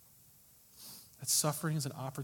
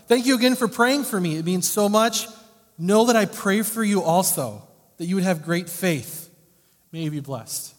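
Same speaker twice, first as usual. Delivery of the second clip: high, loud, fervent thanks; heartfelt letter reading; 5 semitones higher, 12 dB louder than usual. Acted. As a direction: pour out her gratitude.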